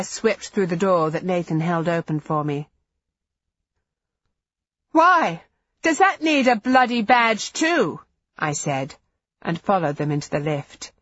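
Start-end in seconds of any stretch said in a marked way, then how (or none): 4.9-8.0 loud, high, furious retort; angry retort. A narrator's reading. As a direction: snap back in fury. Angry retort leaving restaurant.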